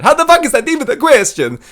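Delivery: high-pitched screaming Italian guy; Marvin Vettori impression. Comic imitating fighter at press conference, screamed Italian impression. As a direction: yell it indignantly with an Italian accent. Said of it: "generic fucking Italian guy fucking screaming".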